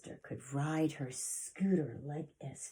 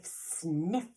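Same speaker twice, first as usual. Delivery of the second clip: dragon sniff; 4 dB louder than usual. Acted.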